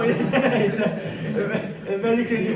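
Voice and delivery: laughing through very cringy; amused chuckle while calling it cringy. Real, not acted. Speaker mocking the toy unboxing videos to youth, laughing.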